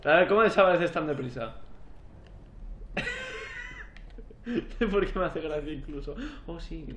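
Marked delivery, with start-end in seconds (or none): 2.3-4.9 long, very high-pitched laugh; high wheezy laugh. Real, not acted. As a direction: break into a drawn-out high giggle. Laughs at the vanishing attacker. (risa aguda y larga)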